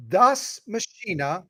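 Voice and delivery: slow, careful "das Maschine"; slow German. Shown as a demonstration. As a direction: stretch out "das Maschine" slowly. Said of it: "So you say".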